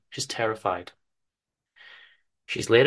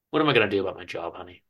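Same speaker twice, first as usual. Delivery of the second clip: rushed, fretful husband; anxious husband rushing his words; hurried worried question; 5 semitones lower, much faster than usual. Acted. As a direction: blurt the worry out fast. Chester frets about job.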